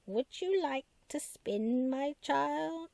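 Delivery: put-on slow, high, whispery old witch. A narrator's reading. Puppet man voicing the witch to Missus Pepperpot, slow high whispered witch voice. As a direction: creep it out like a crone offering a spindle. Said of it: "saying in his old witch voice".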